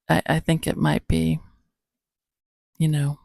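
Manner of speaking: normal talking voice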